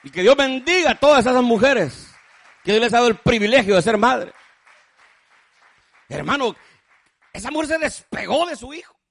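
loud, rising preacher's blessing; preaching. Acted. Preacher blesses the mothers of the congregation, loud preaching. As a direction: lift the blessing over the congregation.

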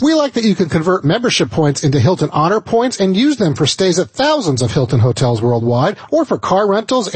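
polished sponsor read, lifted at the start; radio ad read. Acted. Host presents the vacation sponsor to listeners, radio ad read. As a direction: sell it like a radio host reading copy.

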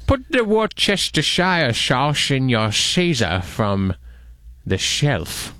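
slow, slurred Scottish-actor impression; hammed-up screen-legend accent; Sean Connery. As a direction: stretch it out with mushy sh sounds. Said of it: "Sean Connery".

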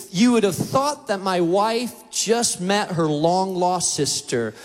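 ordinary talk

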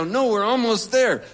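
high, loud hasty cover-up; loud insistence. Retold or imitated. Driver covering his detour to his passenger, high and loud. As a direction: insist it loudly, pitched well up.

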